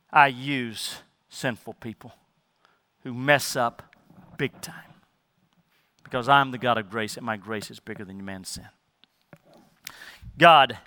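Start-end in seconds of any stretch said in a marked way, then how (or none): 0.1-8.7 voicing God softly. God voiced, soft and intimate.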